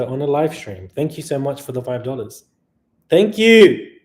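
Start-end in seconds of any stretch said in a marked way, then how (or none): 3.1-3.9 bright thanks. Real, not acted. Thanking a first-time donor.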